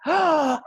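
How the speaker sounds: high, sharp mock gasp of shock. Young colleague gasping at the boss's remark, mock gasp. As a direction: gasp like a scandalised young graduate. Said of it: "with the gasp".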